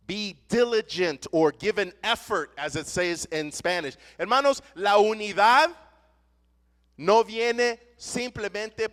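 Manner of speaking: fiery pulpit delivery; impassioned preaching. Real, not acted. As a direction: preach it hard to the congregation. Preaching on effort and unity.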